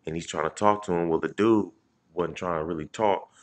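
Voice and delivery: usual speaking voice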